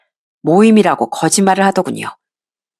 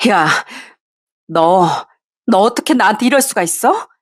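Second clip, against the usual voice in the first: trembling fury. Acted. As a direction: hurl the question at him, shaking with anger. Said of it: "부들부들 떨면서 화를 냈습니다".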